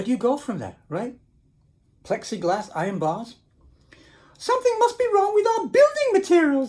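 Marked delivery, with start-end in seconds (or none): 4.4-6.7 high anxious tiny voices; worried little wolves. Acted. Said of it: "they said".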